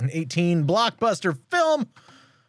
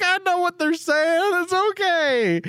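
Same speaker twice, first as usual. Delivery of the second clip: very high retold reply; squeaky mock insistence; high strained reassurance. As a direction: imitate holding it together, pitched way up. Retold reply while fighting laughter.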